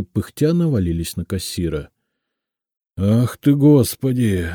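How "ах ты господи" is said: drawn-out, plaintive lament; bewildered whine. A narrator's reading. Cashier mobbed by unpaid staff, bewildered whine. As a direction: moan helplessly, at a loss. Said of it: "растерянно заныл тот".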